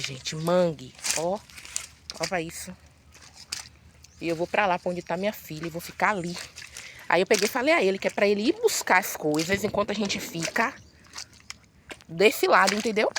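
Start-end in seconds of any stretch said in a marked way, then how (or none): none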